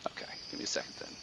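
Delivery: quick breathy whisper aside. Real, not acted. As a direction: whisper it fast under your breath. GM aside to players.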